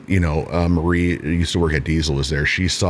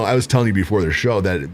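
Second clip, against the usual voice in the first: usual speaking voice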